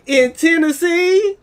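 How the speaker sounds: high, loud, drawn-out squeal of disbelief; incredulous. Real, not acted. Streamer to chatter from Tennessee, high incredulous cry. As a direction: stretch it out in amused disbelief.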